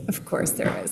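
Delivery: breathy half-whisper; whispered aside. Retold or imitated. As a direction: whisper it dryly. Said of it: "I was like".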